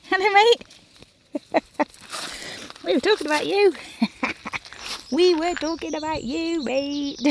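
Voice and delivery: high, loud, laughing hello to the dog; laughing and talking high to the dog; laughing greeting to dog. Real, not acted. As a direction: greet the dog through giggles.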